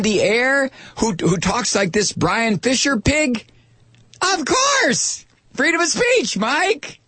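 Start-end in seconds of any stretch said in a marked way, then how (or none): 4.2-7.0 high, indignant mock retort; mocking right-winger. Host mocking a conservative who defends the bigot, high and indignant. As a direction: squeal it like an outraged conservative.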